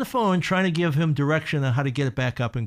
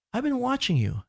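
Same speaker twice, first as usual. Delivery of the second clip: coworker's sincere opening; 10 semitones higher than usual. Retold or imitated. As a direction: begin the coworker's speech pitched up and hushed. Coworker begins a heartfelt speech.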